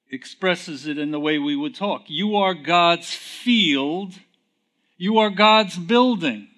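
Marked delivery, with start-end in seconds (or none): 2.1-6.5 slow, building higher and louder; preacher building to a climax; emphatic scripture. Acted. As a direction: proclaim each line. Reading scripture aloud.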